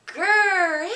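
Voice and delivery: short loud playful growl; quick growl. Pretend. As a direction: snap out a little bear growl.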